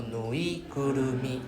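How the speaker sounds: nuigurumi slowly, all parts joined; slow whole word. Shown as a demonstration. Teacher models the whole word slowly. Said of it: "don't rush".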